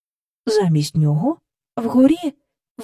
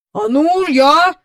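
put-on louder, self-important old man; pompous keeper; 5 semitones higher, 6 dB louder than usual. Park keeper takes over from the children, pompous and loud. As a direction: step in with importance. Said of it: "поважно сказав доглядач".

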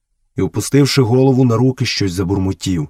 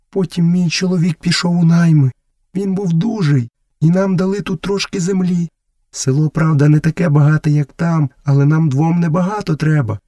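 higher-pitched voice of a mother; village mother; 8 semitones higher than usual. Acted. Messua tells Mowgli about her husband's hard life, village mother. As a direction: read her in a clearly raised pitch.

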